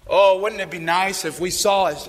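ordinary delivery

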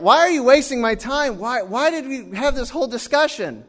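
high-pitched incredulous protest; imitated indignant disbelief; exasperated questioning. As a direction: plead in acted-out frustration. Retold exasperated question to man.

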